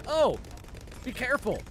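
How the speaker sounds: caring subtitle voice. Retold or imitated. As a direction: sound like a worried grandpa. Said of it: "the subtitles are like".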